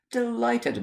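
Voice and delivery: aunt's delighted high greeting; mock delight. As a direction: gush it like a fussy old aunt.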